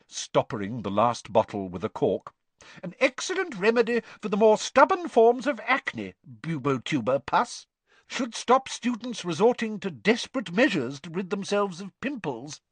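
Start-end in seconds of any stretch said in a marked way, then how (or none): 2.8-12.6 high, brisk schoolmistress; bossy teacher, pitched high. A narrator's reading. Voices Professor Sprout.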